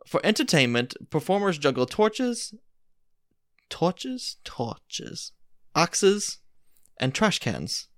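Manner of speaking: hammed-up storyteller voice; playful theatrical narration; dramatic story reading. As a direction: read it like a grand dinner theater tale. Reads the Mad Lib story aloud.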